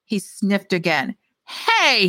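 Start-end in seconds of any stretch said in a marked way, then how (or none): 1.5-2.1 high, sharp outraged "hey"; high outraged cry from a cheated drunk. Acted. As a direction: bark it in outrage. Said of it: "he said, outraged".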